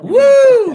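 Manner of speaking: loud, high victory woo; triumphant woo. Real, not acted. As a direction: whoop it out in triumph. Gloating after a cheeky jab.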